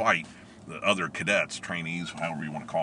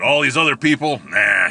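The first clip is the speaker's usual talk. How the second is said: louder dismissive; 5 semitones higher, 9 dB louder than usual. Retold or imitated. Instructor dismissive quote.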